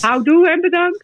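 "Houdoe en bedankt" in a Rotterdam accent, pitched high. Shown as a demonstration. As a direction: Rotterdam accent, rising playfully. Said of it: "op z'n Rotterdams ook wel een keer horen zeggen". (Rotterdams accent)

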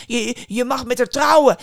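loud, high, stammered plea; pleading outcry. A narrator's reading. King offers his daughter to the boy, desperate pleading. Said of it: "smeekte hem".

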